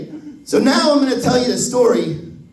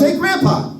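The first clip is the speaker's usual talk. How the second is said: put-on higher, eager kid calling; little kid; 10 semitones higher than usual. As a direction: grandson calling out to grandpa. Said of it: "he goes".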